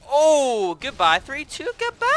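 loud, high gleeful goodbye; gleeful taunt. Real, not acted. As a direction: taunt the enemy as he dies.

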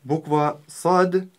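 сад said slowly with a hissing Arabic s; Arabic letter sad. Shown as a demonstration. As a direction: stretch out сад, hard emphatic s. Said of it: "научимся правильно произносить эту букву".

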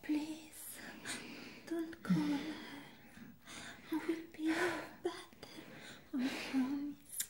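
high, hushed begging voice; desperate pleading. Acted. Patient begging not to be sent back, high and pleading.

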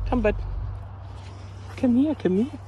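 high, loud call to a dog. Real, not acted. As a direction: call the dog over from across the yard. Calling the dog from afar.

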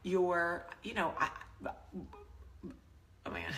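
slow, trailing into breathless unvoiced words; words failing her, fading out; losing her words. Real, not acted. Cohost asks if she can speak, tongue-tied and fading. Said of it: "[name], can you speak?".

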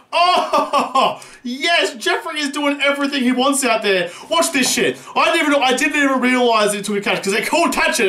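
usual speaking voice

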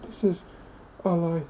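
put-on very high, rising old lady squeak; desperate old lady.

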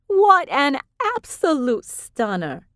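high, slow, admiring coo; gushing Vivian. A narrator's reading.

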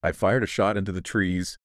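plain reading voice

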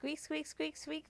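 soft high squeaks; squeaky rubber duck. Pretend.

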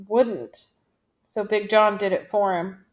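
ordinary delivery

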